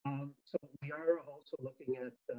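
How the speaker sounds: muffled, bad microphone. Real, not acted. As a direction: voice dulled as if covered. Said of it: "You're very muffled".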